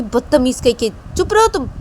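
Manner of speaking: high, loud shut up; angry snap. Acted. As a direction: bite her head off. Shreya snaps "shut up.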